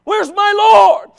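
slow, high, shouted wail; acted grief at full shout, words stretched long; anguished tearful cry. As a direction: wail it out as the weeping woman. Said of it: "Her eyes started to moisten".

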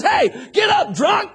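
high shouted scolding; preacher's rebuke. Retold or imitated. Preacher ordering praying Hannah up, shouted rebuke. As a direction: bark her up off the floor. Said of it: "The preacher walks in and says".

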